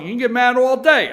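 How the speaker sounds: loud high teasing repeat; loud taunt. Real, not acted. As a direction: shout it at the rival fans. Host taunting rival fans, repeated.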